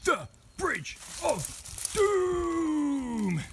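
slow, high epic announcement; dramatic announcer. Pretend. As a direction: boom it like a movie trailer. Naming the scary bridge.

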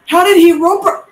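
high, loud disbelieving yell; outraged shout. Real, not acted. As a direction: shout it in protest at the game. Outraged at game move.

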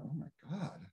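quiet shocked aside; aghast. Retold or imitated. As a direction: mutter it in appalled disbelief. Reacting to rich people.

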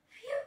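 a high relieved exhale; relieved phew. Retold or imitated. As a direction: let the worry out in one breath. Relief after truck freed.

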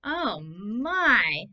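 plain reading voice

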